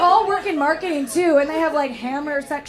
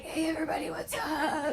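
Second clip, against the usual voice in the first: barely-there croaky voice, much softer; lost voice; 2 semitones higher, 9 dB quieter than usual. Retold or imitated. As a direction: croak it out with almost no voice. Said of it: "I have no voice on a Monday".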